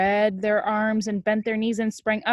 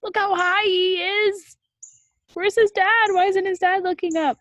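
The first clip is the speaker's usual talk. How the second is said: mock alarm; 13 semitones higher, 7 dB louder than usual. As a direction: sound worried for him. Worried reaction to illustration.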